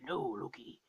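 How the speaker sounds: very low, soft imitation of a father; low, hushed mock-solemn refusal; mock Odin refusal. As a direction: drop deep and quiet, imitating a movie king. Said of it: "And then he's like".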